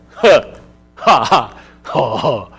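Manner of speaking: short grunting ha noises; a few wordless grunts and noises; guy grunts. Shown as a demonstration. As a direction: grunt it out like teenage boys. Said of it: "We can just kinda go".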